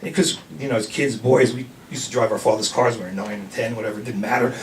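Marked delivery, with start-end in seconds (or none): none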